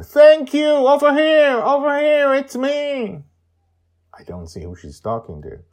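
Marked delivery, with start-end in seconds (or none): 0.0-3.2 high, loud joyful shout. Acted. Joy shouting to the tortoise across the ocean, high and loud. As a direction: call out across the water. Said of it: "she shouts towards the ocean".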